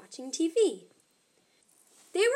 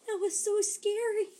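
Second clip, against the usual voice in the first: scared; 7 semitones higher than usual. Acted. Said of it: "said Itty".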